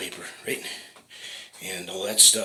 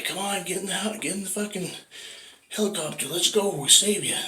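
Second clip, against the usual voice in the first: very high, fed-up urging; exasperated pilot; 10 semitones higher than usual. Acted. Helicopter crew yelling at the man on the roof, very high and exasperated. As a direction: lose patience, get him in now.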